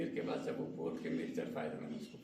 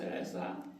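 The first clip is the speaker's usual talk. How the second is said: ordinary talk, nothing marked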